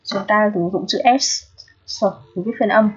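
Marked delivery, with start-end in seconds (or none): 1.9-2.0 s as a hissed consonant, not the letter name; s as in the start of "see"; isolated S sound. Shown as a demonstration. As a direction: sound out s on its own. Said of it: "được phát âm là âm s".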